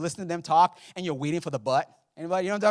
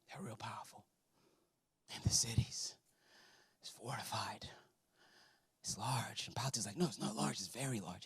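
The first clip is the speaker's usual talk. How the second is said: low, soft, near-whispered suspense; dramatic hushed telling; 4 semitones lower, 11 dB quieter than usual. Acted. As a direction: make them feel the fear. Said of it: "I'm being a little dramatic".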